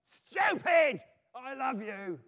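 high exasperated yell, easing on the end; Lucy screaming. Acted. Lucy at the end of her patience, screaming. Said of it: "screams out in a very loud voice".

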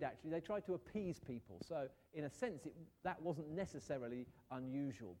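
usual speaking voice

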